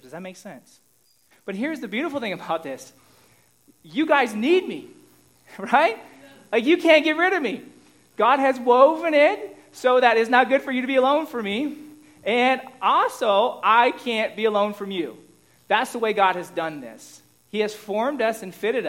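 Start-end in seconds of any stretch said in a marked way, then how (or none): 3.8-15.2 playful teasing. Real, not acted. Pastor teasing congregation playfully.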